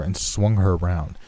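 ordinary delivery

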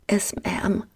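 ordinary delivery

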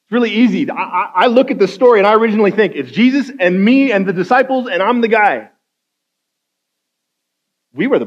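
high, loud, urgent preaching; preaching at full force. Acted. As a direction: drive the point home to the church.

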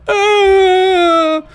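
a huge high ecstatic goal scream; mock the commentator's wild goal cry; imitated orgasmic yell. Imitating a commentator's ecstatic goal scream for his friends. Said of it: "It sounds a little something like".

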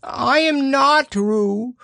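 slow, very high little-creature protest; loud protest. A narrator's reading. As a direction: insist loudly, stretching each word high. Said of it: "said Piglet loudly".

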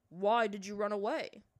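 loud, demanding father; a father calling her to account; stern father. A narrator's reading.

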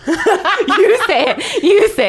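high giggling plea, laughing through each word. Real, not acted. To her co-hosts after botching Boston, laughing. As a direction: crack up while you beg someone else to say it.

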